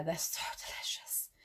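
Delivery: whispered aside about a treat. Real, not acted. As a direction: share it like a delicious secret. Confiding a treat to viewers.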